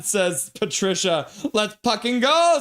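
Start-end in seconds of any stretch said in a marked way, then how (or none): 1.5-2.6 high, loud fan yell read from chat; excited hype shout; fired-up cheer. Acted. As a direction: push up and louder on the last word. Acts out a fan's cheer.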